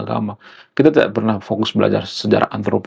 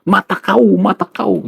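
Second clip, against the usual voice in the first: high, punchy mock Batak outburst; kenceng orang Batak; 9 semitones higher than usual. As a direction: imitate a Batak speaker's sharp retort. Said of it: "Orang Batak juga seperti itu". (menirukan orang Batak ngomong kenceng)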